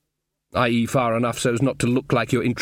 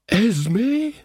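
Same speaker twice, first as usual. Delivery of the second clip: higher, wondering call of her name; surprised Ridcully; 9 semitones higher than usual. Acted. Ridcully meeting Granny again, surprised and high. Ridcully recognises old love.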